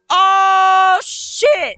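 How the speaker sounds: long, high, loud scream of shock; real jump-scare scream; frightened scream. Real, not acted. Player hit by a jump scare, frightened scream.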